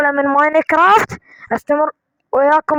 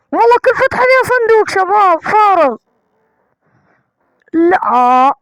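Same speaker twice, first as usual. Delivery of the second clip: high, loud exasperated whine; 6 semitones higher, 4 dB louder than usual. Real, not acted. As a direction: complain in high disbelief. Exasperated empty chests.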